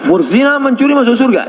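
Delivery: high, disbelieving question; incredulous. Retold or imitated. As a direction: ask in mock disbelief.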